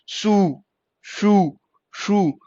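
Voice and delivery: सू and शू with ऊ held long; separate, drawn-out सू, शू; long vowel. Shown as a demonstration. Teacher showing ऊ inside words, long vowel. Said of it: "शू की sound आती है".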